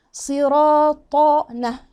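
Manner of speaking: "صراط" chanted slow, dark and full; heavy recitation. Shown as a demonstration. Teacher shows it is all thickened, slow heavy chant. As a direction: thicken and stretch every sound of "صراط". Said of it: "أهي مفخمة أهي".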